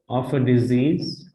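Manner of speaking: usual speaking voice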